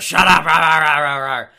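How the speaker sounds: loud garbled yelling; Vince McMahon. Retold or imitated. Podcaster mocking Vince silencing the chants, loud ranting. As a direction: yell it, dissolving into rah-rah ranting. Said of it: "Vince McMahon goes".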